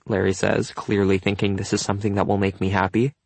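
plain reading voice

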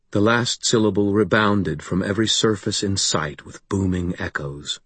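usual speaking voice

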